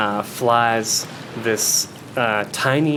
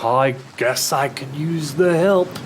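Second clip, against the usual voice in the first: attempted Liverpool accent; 4 semitones higher than usual. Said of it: "I'm not sure where this accent's going. I kinda like the Liverpoolian accent".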